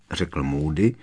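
usual speaking voice

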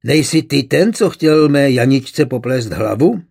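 ordinary delivery

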